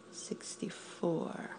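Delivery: normal talking voice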